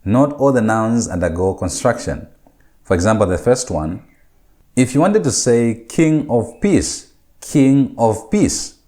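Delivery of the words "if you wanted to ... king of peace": slow teaching delivery. Shown as a demonstration. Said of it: "how do you say that in Hebrew".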